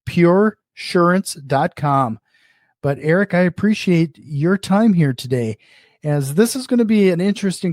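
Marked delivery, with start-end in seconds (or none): 0.0-2.2 pure surance dot com spelled out slowly, each part separated; slow and clear. Shown as a demonstration. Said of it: "make sure that is a clickable link".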